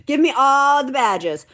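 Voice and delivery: excited shout. Pretend. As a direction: demand the badges like a thrilled kid. Playful repeat of her wish.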